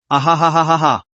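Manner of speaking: hammed-up villain cackle; a scornful ha-ha-ha; sneering laugh. A narrator's reading. Villain laughs off her threat.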